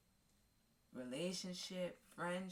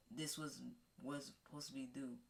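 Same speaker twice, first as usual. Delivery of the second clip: ordinary talk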